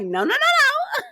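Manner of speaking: very high, slow squealed no-no-no; imitating Mel. Mel refusing to take off her shades, very high and slow. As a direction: stretch each no up high like Mel. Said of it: "Mel was like".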